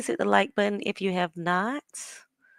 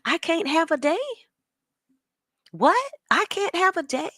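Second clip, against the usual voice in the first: high-pitched imitation of indignant disbelief; mock upset; 8 semitones higher than usual. Mimicking the upset woman for viewers, mock indignant. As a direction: act out her outrage, pitched up. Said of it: "upset. She was like".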